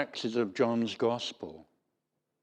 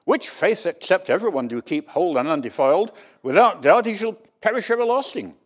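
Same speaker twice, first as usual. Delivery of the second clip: higher, louder, declaiming the creed like a stern proclamation; solemn creed warning; 6 semitones higher, 7 dB louder than usual. Retold or imitated. The preacher reading the Athanasian Creed to the congregation, solemnly declaimed. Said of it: "starts off with a bit of a warning".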